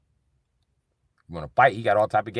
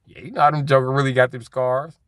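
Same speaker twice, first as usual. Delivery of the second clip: ordinary delivery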